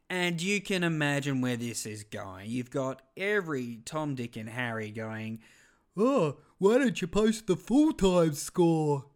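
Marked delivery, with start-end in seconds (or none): 6.0-9.1 slow, loud, high mocking whine; mock a whining Facebook commenter; drawn-out nagging sneer; whiny commenter voice. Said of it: "That's just the voices I went to".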